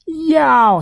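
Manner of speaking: very high, drawn-out storybook yelp; pained yelp. A narrator's reading. Small pig bitten on the tail, yelping. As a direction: cry out high as if nipped. Turtle bites pig's tail.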